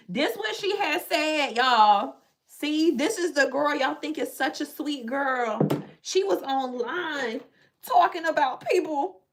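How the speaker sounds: high, loud gossip calling someone out; hammed-up high, loud exposé; mock accusers. Voicing future accusers exposing her.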